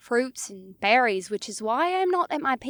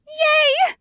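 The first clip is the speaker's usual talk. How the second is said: high, loud happy yay; excited cheer; 7 dB louder than usual. A narrator's reading. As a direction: burst out with glee. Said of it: "a refreshing burst of excitement".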